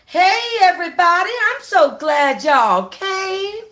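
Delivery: high, loud and drawn-out welcome; excited greeting. Acted. Aunt welcoming her guests at the door, excited and drawn-out. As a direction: throw the door open and gush at the guests, slowly. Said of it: "she said excitedly".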